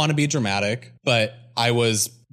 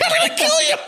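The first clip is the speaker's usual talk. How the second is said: much higher, louder, shrieking through laughter; laughing mock threat; 6 dB louder, faster than usual. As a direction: scream the threat while cracking up.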